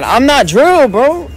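ordinary talk

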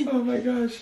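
real crying, words squeezed out quietly. Real, not acted. Overwhelmed by the partner's song, crying softly. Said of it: "I'm crying right now".

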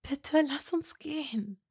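high, slow plea; pleading. A narrator's reading. Hermine pleading with Malfoy, slow. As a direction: beg gently.

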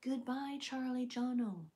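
lightly voiced smart-speaker assistant; Alexa voice. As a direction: say it like a polite voice assistant. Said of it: "as Alexa?".

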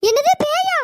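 loud, high-pitched shocked question; jolted, shrill-pitched disbelief; startled. Acted. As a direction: blurt it out in sudden alarm. Startled at news of ghost.

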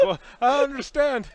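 high, loud puzzled voice; cartoonish disbelief; mock baffled whine. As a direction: play the confused victim.

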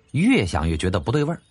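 plain reading voice

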